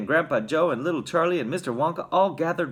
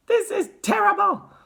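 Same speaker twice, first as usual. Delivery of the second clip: very high, loud wail; distraught mother's high cry; 6 dB louder than usual. A narrator's reading. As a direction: wail it in despair. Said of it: "wailed Missus T V".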